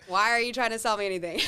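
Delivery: loud mock complaint, quickly said; mock protest. Friends and family balking at a sales pitch, loud mock protest. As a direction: push back loudly, as a playful imitation.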